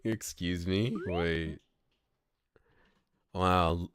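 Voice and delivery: ordinary delivery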